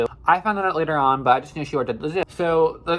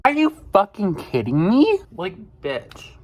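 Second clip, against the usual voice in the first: loud, higher-pitched burst of disbelief; outraged; 7 semitones higher, 3 dB louder than usual. Real, not acted. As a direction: explode in indignation at the boss. Outraged reaction to boss's reply.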